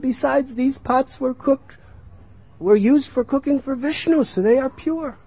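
put-on high-pitched child's reasoning; arguing child. Child defends the pots to his mother, arguing. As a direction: argue it in a high voice.

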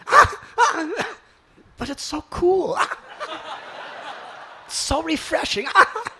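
high pained moans between delighted words; pained yet blissful bather; mock protest. Stung devotee refusing to leave, high pained delight. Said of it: "the person's going".

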